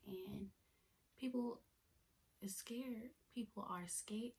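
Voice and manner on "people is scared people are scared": whisper. Real, not acted. Practising the phrase in a whisper.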